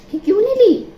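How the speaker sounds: sudden high, loud cry of regret; excited outburst. Real, not acted. Vlogger wishing she had taken the dish, excited outburst.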